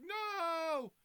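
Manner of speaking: high, loud drawn-out no; acted cry of dismay. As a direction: cry out as the hero's sidekick in dismay. Movie retelling, character cries out.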